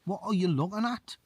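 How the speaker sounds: squaring up to someone staring at you; confrontational. Pretend. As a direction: pretend challenge to a staring stranger.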